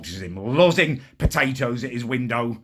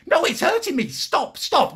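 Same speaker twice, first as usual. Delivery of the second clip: high, loud pretend cries of pain; much higher hammed-up pleading; mock pained squeal; 4 dB louder than usual. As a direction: squeal as if it hurts. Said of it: "I'm like".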